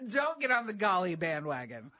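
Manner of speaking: ordinary delivery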